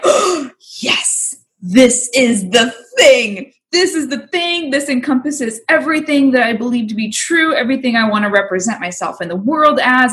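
high, giddy retelling of a brand-new idea; excited revelation. Retold or imitated. Acting out excited title idea.

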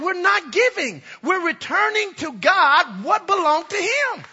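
high, loud preaching climb; preaching at full force. Acted. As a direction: drive the point home.

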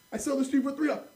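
high, loud haggling pitch; pushy vendor. Retold or imitated. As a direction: imitate an insistent street seller. Acted haggling with pushy street vendor.

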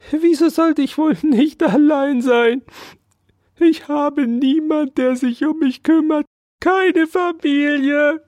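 high, squeaky self-pitying mouse; high mouse voice, slowing into a wail; pitiful mouse. Acted. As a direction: whine like a poor little mouse. Mouse pleads poverty.

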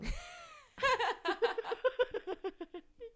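high, loud cackling laugh; laughing fit. Real, not acted. Laughing with friend over missing him, high burst of laughter. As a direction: crack up at your own story. Laughing at own retold line.

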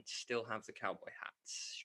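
whispered aside; breathy whispered joke; whispering. Real, not acted. Quiet aside about cowboy hats.

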